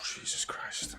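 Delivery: real whisper after a long breath out; whispered relief. Real, not acted. Fan after a penalty scare, whispered relief. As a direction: breathe it out with no voice, shaken.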